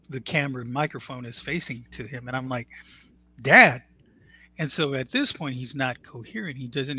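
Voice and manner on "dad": loud sharp call across the room. Retold or imitated. Son calling his unresponsive father through the phone, loud call. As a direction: call out to get his attention.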